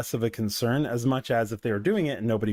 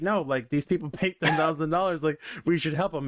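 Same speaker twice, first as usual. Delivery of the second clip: imagined assistant pleading; 3 semitones higher than usual. Retold or imitated. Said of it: "an imaginary scenario".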